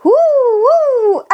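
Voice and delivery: excited whoop. Acted. Flying carpet joyride.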